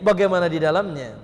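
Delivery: ordinary delivery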